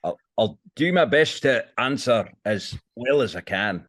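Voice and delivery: slow, careful Scottish brogue; Scottish accent. Acted. Guest answers hosts in a put-on Scottish accent. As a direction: answer like a proud Scotsman. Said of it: "could we ask a Scottish Brian".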